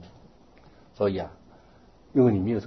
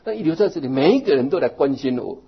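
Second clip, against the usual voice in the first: put-on old lady complaining; 4 semitones higher, 7 dB louder than usual. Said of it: "她说".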